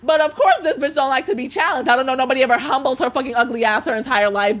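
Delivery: ordinary delivery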